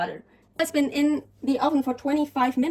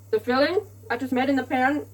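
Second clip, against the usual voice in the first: ordinary talk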